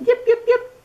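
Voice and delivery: very high, far yipping fox; fox calling. Acted. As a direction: yip out like a little fox calling. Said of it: "She perked up her ears and called".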